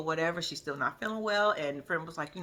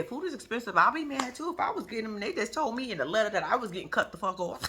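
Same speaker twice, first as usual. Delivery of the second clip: ordinary delivery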